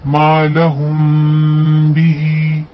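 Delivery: मा लहुम बिही recited slowly, the म before ब hidden and nasal; slow tajweed recitation of मा लहुम बिही; ikhfa shafawi. Shown as a demonstration. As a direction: recite मा लहुम बिही with lips lightly parted on the म. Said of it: "इक्फा शफवी का कायदा होगा".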